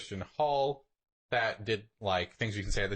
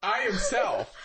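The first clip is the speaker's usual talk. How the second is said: mocking high; 9 semitones higher, 5 dB louder, slower than usual. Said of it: "mocking friend's words".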